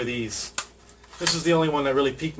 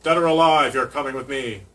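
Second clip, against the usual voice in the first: light imitation of a robot cop; RoboCop; 4 dB louder than usual. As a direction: quote it like a stern cyborg cop. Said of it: "Love me some RoboCop".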